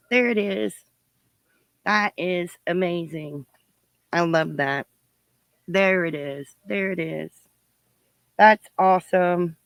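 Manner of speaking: normal talking voice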